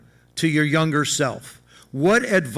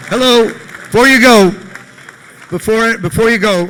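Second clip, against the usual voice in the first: loud, raised call to the room; 5 semitones higher, 9 dB louder than usual. Real, not acted. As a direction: call everyone back loudly. Calls crowd back before leaving.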